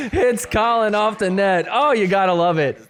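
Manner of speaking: high, amused excited call; gleeful play-by-play right after laughing; delighted commentary. Real, not acted. Delighted at a player getting hit.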